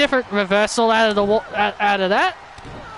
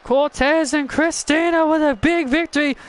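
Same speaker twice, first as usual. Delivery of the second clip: ordinary talk, nothing marked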